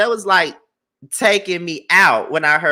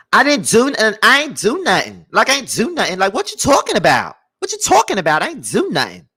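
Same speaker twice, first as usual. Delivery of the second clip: higher, swooping New York–New Jersey imitation; New York accent; 6 semitones higher than usual. Mimicking people up north on visits to dad, playful New York accent. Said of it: "to New York or New Jersey to visit my dad and I hear them people talk".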